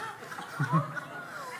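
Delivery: soft, breathless laugh; breathy laugh. Real, not acted. Laughing about the charging pig.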